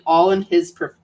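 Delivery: ordinary delivery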